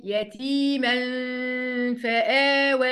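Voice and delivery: "يتيما" held in a nasal hum before "فآوى"; ikhfa with ghunna. Shown as a demonstration. Teacher showing students how to say it, "يتيما" with held ghunna. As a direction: chant "يتيما فآوى", hiding the nun in a hum. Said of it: "فقلت إيه إزاي؟".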